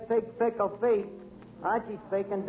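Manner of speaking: ordinary delivery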